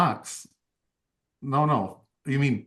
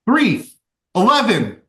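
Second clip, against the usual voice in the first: high, loud eager shouts; screaming out numbers; 7 semitones higher, 5 dB louder than usual. Acted. Buck shouts guesses at the cat's number game, loud and high. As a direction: blurt the guesses out at the top of your voice. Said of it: "Buck is just screaming out numbers".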